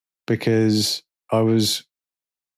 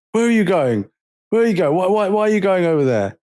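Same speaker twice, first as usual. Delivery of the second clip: mock demanding man; 6 semitones higher, faster than usual. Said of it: "he ran over to us like".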